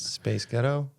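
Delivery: put-on American accent. Shown as a demonstration. Co-host testing the host's accent trick, American accent. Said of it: "in an American accent".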